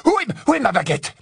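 high, frantic angry demand; fureur. Acted. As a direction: shout for it in a rage. Hermione cherche sa baguette, furieuse. (exigence furieuse, voix aiguë)

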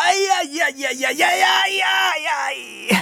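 very high, drawn-out "aïe" yelps; howl of pain. A narrator's reading. Schoolboy struck by the cane, howling. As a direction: shriek a run of pained yelps. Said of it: "il hurla".

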